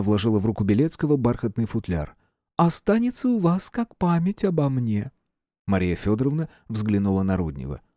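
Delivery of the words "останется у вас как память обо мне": put-on gracious old empress. A narrator's reading. Empress voiced in story.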